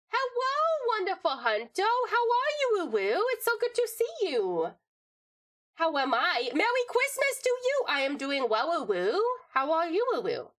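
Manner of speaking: put-on high, cutesy baby greeting; baby Takubu voice. Greeting chat still in the redeemed baby Takubu voice, high and cutesy. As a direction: squeak hello like a tiny creature. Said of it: "me talking like this".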